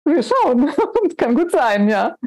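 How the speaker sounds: cheeky laugh through the words; teasing, laughing answer. Real, not acted. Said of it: "spitzbübische Art zu lächeln und zu lachen".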